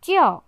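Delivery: 叫 in Mandarin fourth tone, falling sharply from high. Shown as a demonstration. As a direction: drop 叫 hard from high. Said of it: "the fourth tone".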